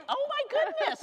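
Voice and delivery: delighted surprise. Real, not acted. Old memories coming back.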